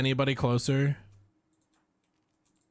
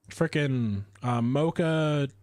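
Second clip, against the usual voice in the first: ordinary talk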